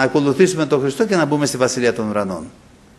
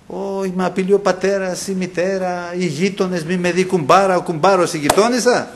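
higher-pitched, fretful list of threats; mock coward; 3 semitones higher than usual. Preacher mimics cowards fearing father, mother and neighbours, mock-fretful. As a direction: play a worried coward counting who objects. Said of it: "οι δειλοί δεν θα μπουν".

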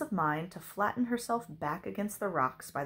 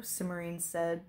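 plain reading voice

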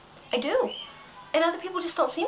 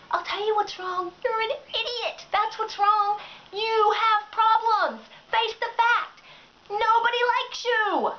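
higher pitched, slowing to hammer each jab; exasperated rant; 7 semitones higher, slower than usual. Real, not acted. Venting at the Facebook complainer, exasperated. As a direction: tell her off, spelling it out. Ranting at the attention seeker.

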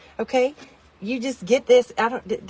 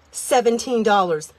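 usual speaking voice

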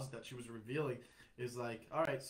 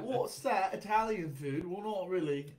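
high, loud mock British voice; bad British accent; 6 semitones higher, 7 dB louder than usual. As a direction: put on a hammed-up British accent. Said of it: "that's not the best British accent".

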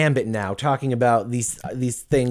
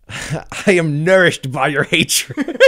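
slow, louder mock yell; defiant yell back; 4 semitones higher, 5 dB louder, much slower than usual. Rich businessman answering his protesters, mock defiant yell. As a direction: yell it back, drawing the words out. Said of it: "he just yells back at them".

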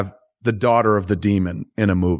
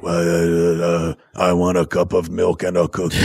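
lightly growled Batman inner thought; a hint of the gravel Batman voice on a silly thought; mock Batman voice; 4 semitones lower than usual. Mocking Batman's inner monologue in a light growl. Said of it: "why the fuck does he think in that voice".

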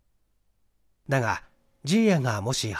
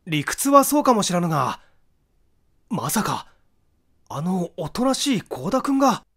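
higher, louder protest that it can't be true; high, raised disbelief breaking into the argument; disbelieving interjection; 7 semitones higher, 5 dB louder than usual. A narrator's reading. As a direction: cut in, refusing to believe it of a gentle friend. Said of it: "信じかねて口を挟んだ".

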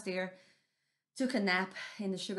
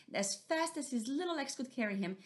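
usual speaking voice

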